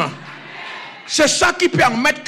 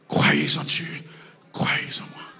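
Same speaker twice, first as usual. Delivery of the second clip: hushed scripture; 9 dB quieter than usual. Acted. Quoting scripture softly.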